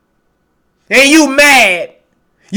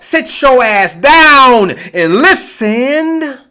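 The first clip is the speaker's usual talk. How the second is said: very slow, drawn-out order; slow command; much slower than usual. Real, not acted. Preacher ordering doubters to sit and listen, very slow. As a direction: lay it down word by word.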